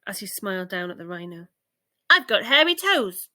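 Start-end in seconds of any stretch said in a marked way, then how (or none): 2.1-3.3 high, loud proud shout. Acted. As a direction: yell it out happily. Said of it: "shouted Ike".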